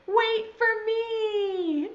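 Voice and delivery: high, long-drawn call; mild storybook yell. Acted. Caterpillar calls to the others climbing the tree, drawn-out yell. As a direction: call after them, stretching every word. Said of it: "And then he yells".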